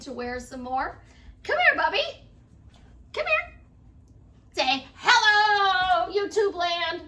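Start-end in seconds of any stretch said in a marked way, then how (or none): 1.4-3.5 very high, loud coaxing call. Real, not acted. Grandmother calling little boy over to her, high coaxing. 4.5-7.0 loud, drawn-out high hello; excited greeting. Real, not acted. Grandmother prompting toddler to greet viewers, exuberant.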